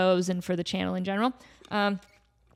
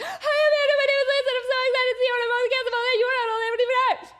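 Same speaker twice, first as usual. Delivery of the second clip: very high, rushed gushing babble; gushing fangirl; much faster than usual. Retold or imitated. Starstruck fan babbling to her idol, very high and fast. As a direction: gush it all out at once, breathless. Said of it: "And I was just like".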